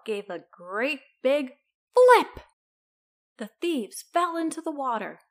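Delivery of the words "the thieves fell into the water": ordinary delivery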